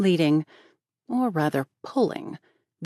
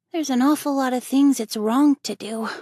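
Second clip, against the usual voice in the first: sigh; 8 semitones higher, 4 dB louder than usual. Acted. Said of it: "said Davy with a sigh".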